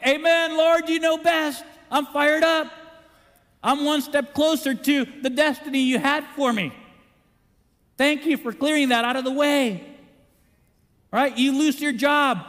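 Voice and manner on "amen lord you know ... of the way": loud, high, eager thanksgiving; a hint of joyful praise; fired-up amen. Believer answering God after a breakup, eager and loud. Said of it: "What should you say?".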